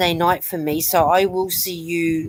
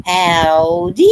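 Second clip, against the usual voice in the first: louder, rising howdy; Granny D's howdy; 7 dB louder than usual. Retold or imitated. A granny saying howdy, imitated with a rising howdy. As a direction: greet like Granny D. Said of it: "I like Granny D when she goes".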